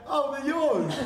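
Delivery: loud, high mock-innocent realisation; feigned surprise. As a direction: act caught out, laughing through it. Caught stealing his plates, feigns innocence.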